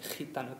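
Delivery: "gitano" said khee-TA-no, softly; soft "gitano" with a jota opening; throaty G. Shown as a demonstration. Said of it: "comes from our throat".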